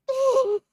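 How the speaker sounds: shrieking giggle; loud tittering; high nervous laugh. Real, not acted. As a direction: laugh off the fright.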